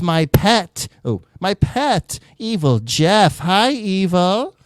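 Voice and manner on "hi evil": ordinary talk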